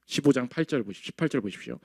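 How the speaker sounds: normal talking voice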